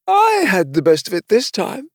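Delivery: high-pitched character voice; boastful unicorn. A narrator's reading. Unicorn strolling past the King after the fight, boastful.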